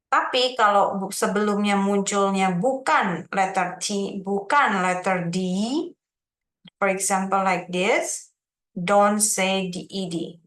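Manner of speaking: ordinary talk, nothing marked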